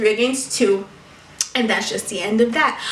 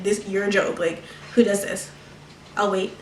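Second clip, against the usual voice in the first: low, soft, unimpressed aside; retold deadpan dismissal; unimpressed look; 4 semitones lower, 7 dB quieter than usual. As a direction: drop it low and quiet, dismissive. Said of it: "looking at her like".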